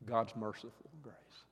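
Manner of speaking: whispered softly; hushed whisper. Real, not acted. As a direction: breathe it out in a whisper. Tender aside about God's grace.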